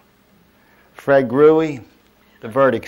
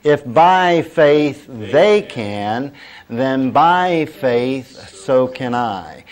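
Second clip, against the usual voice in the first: very slow, drawn-out "by faith", raised and louder; leading group recitation; 2 semitones higher, 5 dB louder, much slower than usual. Shown as a demonstration. Preacher has congregation say it along, slow and emphatic. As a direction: stretch each word so a crowd can join. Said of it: "Say that with me".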